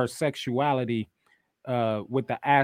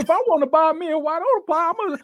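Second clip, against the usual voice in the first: high, loud pleading argument; mock protest; 9 dB louder than usual. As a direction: protest loudly in a hammed-up high voice. Acted quarrel over buying pie.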